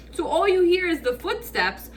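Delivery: ordinary talk, nothing marked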